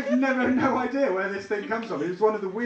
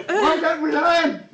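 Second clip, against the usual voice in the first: mock exasperation; 11 semitones higher, 6 dB louder, slower than usual. Mock despair over family sausages.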